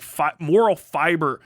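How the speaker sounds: normal talking voice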